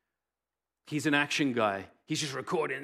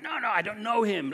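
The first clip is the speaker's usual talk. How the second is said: high, pushed denial; panicked denial; 8 semitones higher than usual. Acted. Peter denying Jesus to his accusers, panicked high voice. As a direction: blurt it out in a panic.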